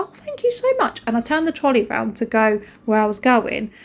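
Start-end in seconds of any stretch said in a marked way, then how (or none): 0.0-1.0 sweet high thanks. Retold or imitated. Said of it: "I said".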